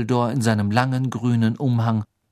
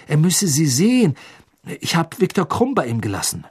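higher-pitched, earnest urgent report; agitated Harry; 5 semitones higher than usual. Acted. Harry describing Crouch to Dumbledore, urgent and higher. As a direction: pour out the account, voice pitched up.

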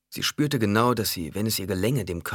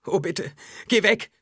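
very high, louder begging cry; desperate pleading; 14 semitones higher, 5 dB louder than usual. A narrator's reading. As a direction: beg frantically, voice rising sharply. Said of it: "bettelte Alia". (verzweifelt flehend, sehr hoch und laut)

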